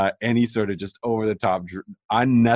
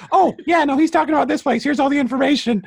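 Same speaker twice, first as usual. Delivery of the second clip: much higher, perky helpful voice; chirpy computer Gideon; 6 dB louder than usual. Retold or imitated. Ship AI casually explaining to the crew, high and chirpy. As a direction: spill everything cheerfully like an eager assistant. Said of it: "Yo, what the fuck was that".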